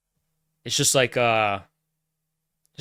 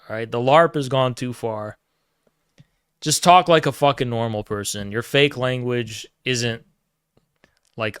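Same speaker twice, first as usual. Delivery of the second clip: usual speaking voice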